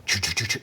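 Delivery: rapid choo-choo jackhammer noise; imitated jackhammer. Storyteller imitating the jackhammer up above, staccato noise. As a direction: make a staccato drilling sound with the mouth. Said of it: "The next thing I hear is a jackhammer".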